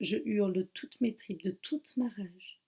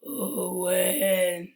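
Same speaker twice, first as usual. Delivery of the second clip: a booming call from the dark; loud, drawn-out calling of his name; masked killer calling the deputy by name, booming; cavernous voice; 7 dB louder than usual. Acted. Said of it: "Une voix caverneuse profonde lui répond".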